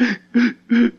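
imitated crying hiccup breaths; hiccuping sob gasps. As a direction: gasp in jerks like a sobbing child. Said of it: "breathing hiccup type weird thing".

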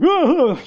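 high, loud mock sobbing gasps; imitated crying gasps. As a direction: copy dad's joyful crying gasps. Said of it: "he was still crying".

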